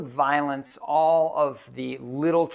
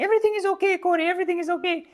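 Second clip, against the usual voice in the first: very high, loud squeaky reassurance; imitating Simone's high voice; 5 dB louder, much faster than usual. Quoting the climbing partner digging him out of the avalanche, high squeaky voice. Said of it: "he was saying".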